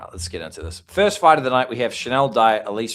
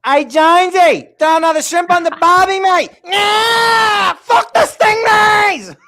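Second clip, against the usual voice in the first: loud, high hammed-up Aussie yell; Australian accent; 12 dB louder, slower than usual. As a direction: bellow it like a rowdy Aussie. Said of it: "I got this".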